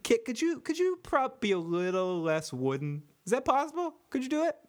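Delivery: high, pleading mock director; mock director plea. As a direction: coax the actor in a silly high voice. Mocking director asking Kit.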